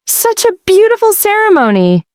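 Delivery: high, gushing wedding guest voice. A narrator's reading. Wedding guest gushing at the bride, high and sweet. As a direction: coo it like an excited aunt.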